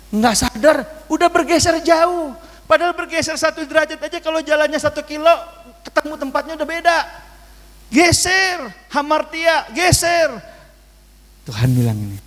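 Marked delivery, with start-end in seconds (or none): 0.1-10.4 higher pitch, rising fervor; impassioned preaching. Real, not acted. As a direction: drive the warning home. Preaching at full force.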